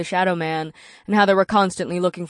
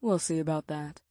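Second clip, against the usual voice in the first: low, soft teasing purr; succubus purr; 4 semitones lower, 9 dB quieter than usual. A narrator's reading. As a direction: purr it with a sly smirk. Succubus voice continues.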